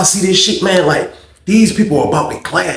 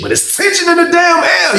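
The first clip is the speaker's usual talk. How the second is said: suddenly very high, straining voice; dramatic emphasis. Real, not acted. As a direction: shoot up high to sell the tension. Dramatizes the tense atmosphere.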